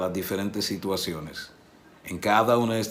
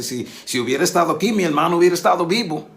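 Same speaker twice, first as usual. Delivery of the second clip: reproachful; 7 semitones higher, 5 dB louder, faster than usual. Retold or imitated. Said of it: "el tono de, de reclamación".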